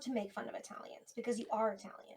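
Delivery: whispered aside; whispering. Real, not acted. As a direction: whisper it conspiratorially. Conspiratorial aside.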